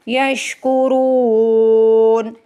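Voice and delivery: यशकुरून with a full thick रू drawn long and a silent stop on न; thick, stretched रू, end यशकुरून on साकिन नून; heavy ra, stop on nun. Shown as a demonstration. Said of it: "रॉ को मोटा भी पढ़ा जाएगा". (यशकुरून में रॉ मोटा, खींच कर, नून साकिन)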